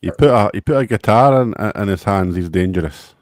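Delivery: usual speaking voice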